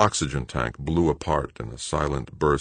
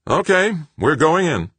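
tense commander; 13 semitones higher, 5 dB louder than usual. A narrator's reading. Brenard starting descent.

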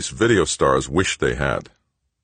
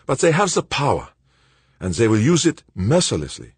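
put-on higher, quicker voice for the anxious old friend; Verban, worried old colleague; 8 semitones higher, 3 dB louder than usual. A narrator's reading. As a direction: voice Verban as tense and urgent. Verban's voice in the read story.

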